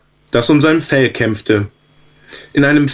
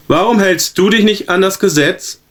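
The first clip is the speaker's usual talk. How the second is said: higher, a touch of an angry prince; mock-thunder at the disobedient subject; thundering demand; 7 semitones higher than usual. A narrator's reading. Prince scolds the stubborn zebra, lightly thundering. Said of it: "donnerte der Fürst das Zebra an".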